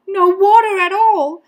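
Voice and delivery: high, loud dismay; alarmed. A narrator's reading. Boy narrating his fish without water, high alarm. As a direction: exclaim it in alarm.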